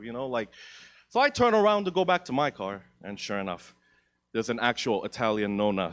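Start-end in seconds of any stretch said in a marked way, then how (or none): none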